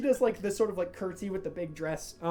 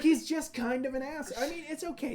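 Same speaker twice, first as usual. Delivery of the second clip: Malachi's voice; 7 semitones higher than usual. Acted. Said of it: "He's like".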